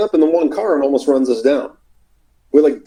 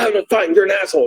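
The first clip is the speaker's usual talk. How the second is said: mock angry; 7 semitones higher, much faster than usual. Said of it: "She gets, like, right mad at me".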